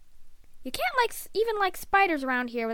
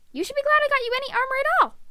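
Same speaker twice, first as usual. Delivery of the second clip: high, fast rebuke; indignant scolding; 11 semitones higher, 4 dB louder, much faster than usual. Acted. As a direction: fire back at an ungrateful son. Mother snaps back in argument.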